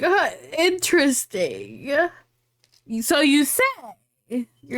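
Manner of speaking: slow, drawn-out mom reaction; skeptical mom. Retold or imitated. Mom questioning her daughter's would-be boyfriend, slow and skeptical. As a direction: imitate a mom taking it in very slowly. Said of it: "my mom would look at you like".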